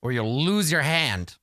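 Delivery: high-pitched, animated sorcerer lecture; excitable sorcerer. Acted. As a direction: seize the thought and warn with relish. Sorcerer resumes his lecture.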